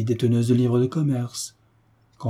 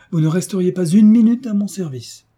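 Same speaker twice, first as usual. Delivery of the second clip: higher, louder warning; stern threat; 7 semitones higher, 7 dB louder than usual. A narrator's reading. Young mistress warning her governess of dismissal, sternly threatening.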